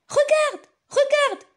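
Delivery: high, loud excited call; excited cry. Acted. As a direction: call out with delight. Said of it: "s'écria maman".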